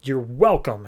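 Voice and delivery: the villain snaps at her former friend in mock rage; angry outburst. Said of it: "Cheetah starts getting super angry".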